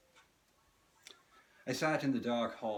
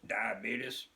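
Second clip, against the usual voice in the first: Wilford Brimley impression; 3 dB louder than usual. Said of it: "here's my Wilford Brimley impression".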